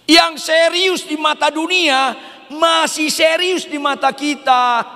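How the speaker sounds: impassioned pulpit shout; full-force preaching. Real, not acted.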